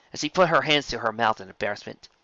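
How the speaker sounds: usual speaking voice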